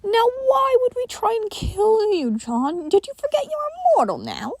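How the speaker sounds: sinister creature teasing; mocking entity. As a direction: taunt him playfully. Helen mocking John.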